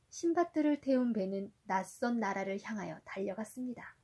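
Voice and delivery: gentle storybook reading for a little one; reading to a toddler. A narrator's reading. Parent reading Sindbad to a child. (아이에게 동화 읽어주듯)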